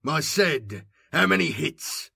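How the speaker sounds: loud impatient snap; snapping. A narrator's reading. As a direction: snap the question at him. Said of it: "the old man snapped".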